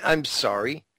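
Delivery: ordinary talk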